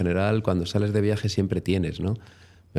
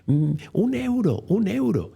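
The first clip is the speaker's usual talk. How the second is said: put-on much higher, slow, insistent old lady voice; imitating the shouting lady; 11 semitones higher, slower than usual. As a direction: squeak out the lady's demand for a euro. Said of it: "la señora le gritaba". (señora exigiendo con voz aguda y lenta)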